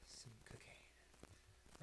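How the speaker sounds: hushed whisper; breathy secret whisper. Acted. Whispered aside.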